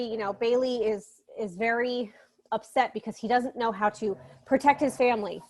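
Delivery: normal talking voice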